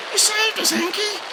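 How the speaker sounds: very high thrilled exclamation; exclaim. A narrator's reading. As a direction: gasp it gratefully. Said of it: "Shishi exclaims".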